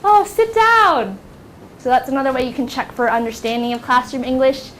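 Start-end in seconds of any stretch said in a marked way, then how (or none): 0.0-1.2 put-on high, slow, eager kid answer; guessing pupil. Student guessing the charade, high and drawn out. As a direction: imitate a pupil who just got it. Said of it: "students can raise their hand and say".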